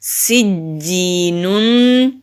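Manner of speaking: slow, drawn-out Quranic recitation of the word. Shown as a demonstration. Teacher reciting for her students, slow melodic recitation. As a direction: recite it slowly, stretching each sound. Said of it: "نون دو پیش نون".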